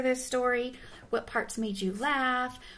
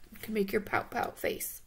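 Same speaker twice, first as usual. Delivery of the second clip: ordinary delivery, nothing marked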